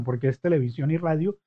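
ordinary delivery

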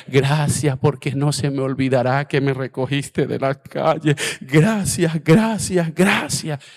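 loud, rising cries of gracias; fervent shouted thanks. Retold or imitated. Pastor praying aloud to the Lord, loud and fervent. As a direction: cry out your thanks to God. Prayer of thanks at full force.

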